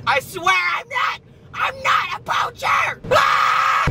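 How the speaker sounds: very high, strained mock scream; panicked protest. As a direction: plead your innocence in a drawn-out squeal. Acted panicked protest to landowner.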